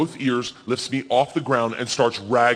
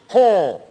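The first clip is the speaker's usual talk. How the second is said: loud, high falling "huh"; wife's bear call; 7 semitones higher, 6 dB louder than usual. Acted. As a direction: beckon a bear with one bold "huh". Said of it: "stands by my feet and goes".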